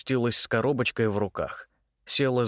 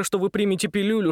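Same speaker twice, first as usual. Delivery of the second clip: higher, fast nervous gabble on "пилюлю"; rattling off fast; 8 semitones higher, faster than usual. Acted. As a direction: rush through "пилюлю" anxiously. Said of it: "затараторил он". (тараторит взахлёб)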